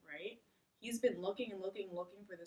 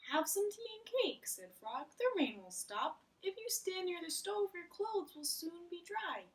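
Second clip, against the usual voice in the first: higher, kindly Frog voice comforting his friend; Frog's voice; 9 semitones higher than usual. Acted. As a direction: speak as Frog, the cheerful friend, pitched up. Voices Frog in read-aloud.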